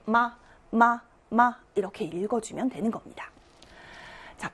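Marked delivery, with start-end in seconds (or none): 0.1-1.6 마 said short and light; toneless, clipped-short 마; neutral tone. Shown as a demonstration. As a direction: let each 마 go briefly, without weight. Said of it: "짧고 가볍게".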